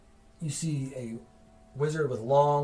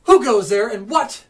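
higher, louder outraged demand; indignant wizard; 6 semitones higher, 9 dB louder than usual. Acted. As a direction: challenge the intruder. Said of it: "He comes over and is like".

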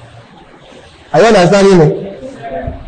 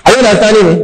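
usual speaking voice

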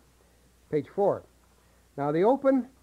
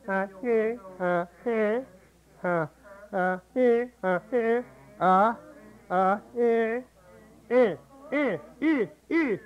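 higher, pinched eh from the back of the throat; throaty eh; 4 semitones higher, slower than usual. Shown as a demonstration. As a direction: push the eh way back. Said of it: "make it sound like it's in the back".

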